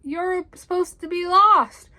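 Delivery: high, loud stammering disbelief; surprised cry. A narrator's reading. Rabbit finds Tigger not lost, sputtering surprise. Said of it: "Bunny cried in surprise".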